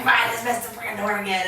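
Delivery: fast, exasperated mock outburst; mock frustration. Imagined person fuming at a missed door slam, mock frustration. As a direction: blurt it out in playful exasperation.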